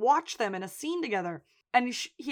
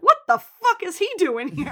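high, loud indignant outburst; outraged quote; 9 semitones higher, 9 dB louder than usual. Retold or imitated. Lip seeing Jimmy arrive, outraged loud demand. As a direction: bark it out in outrage. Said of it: "He's like".

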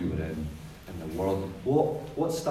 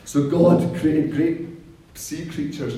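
emphatic slow proclamation; 7 semitones higher, 9 dB louder, slower than usual. Acted. Dramatic emphasis in scripture reading.

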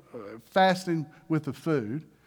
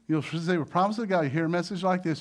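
usual speaking voice

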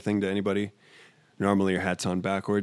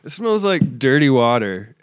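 disgusted; 4 semitones higher, 5 dB louder than usual. Real, not acted. Disgusted at the smell.

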